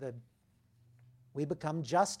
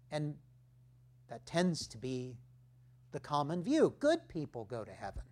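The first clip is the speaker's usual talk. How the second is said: ordinary talk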